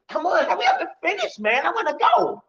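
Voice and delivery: high, urgent nagging voice; impatient inner voice. Acted. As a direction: whine to hurry up and leave. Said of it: "Like yourself is telling you".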